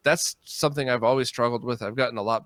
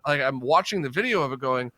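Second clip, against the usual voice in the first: normal talking voice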